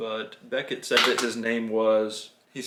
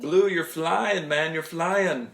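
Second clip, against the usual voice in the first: 1 excited cheer; 5 semitones higher, slower than usual. Real, not acted. Dad cheers flying toy.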